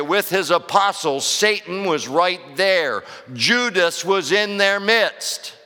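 high, loud pulpit proclamation; forceful preaching. Real, not acted. Preacher warning the church that evil gets inside, forceful preaching. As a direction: preach it hard, drawing out the key phrases.

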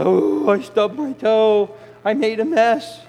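high, slow self-pitying whine; whiny complaint. Acted. Sinner whining to God after the mess, high and slow. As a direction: whine like a hurt child.

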